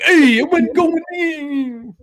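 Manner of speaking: high, slow, hammed-up whimpering; mock crying. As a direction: whine it like a fake-crying mechanic. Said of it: "He's like".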